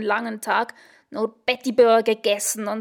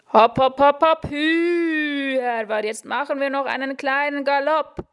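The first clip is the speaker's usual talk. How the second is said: a hint of a higher, chanting horse driver; instructor urging; 4 semitones higher than usual. Instructor ignores her and chants the horse on.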